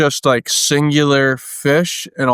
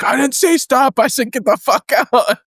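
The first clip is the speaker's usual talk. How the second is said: high-pitched furious barking of orders; drill instructor yell; 11 semitones higher than usual. Retold or imitated. Sergeant screaming at a recruit during group PT, furious high yell. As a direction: scream him out of the squad.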